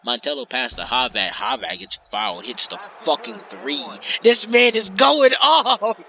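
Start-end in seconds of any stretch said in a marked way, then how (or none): 4.2-6.0 loud, high, laughing hype; excited yell. Real, not acted. Commentator hyping Havat's hot streak.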